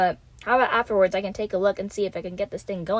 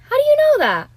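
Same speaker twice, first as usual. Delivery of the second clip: suspicious surprise; 9 dB louder than usual. Acted. Realises detective knows too much.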